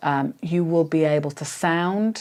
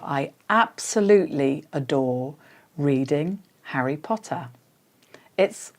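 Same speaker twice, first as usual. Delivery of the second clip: bit by bit; 2 semitones lower, 5 dB quieter than usual. Shown as a demonstration. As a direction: pause between "adore", "reading" and "Harry Potter". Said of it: "doing it little bit by little bit".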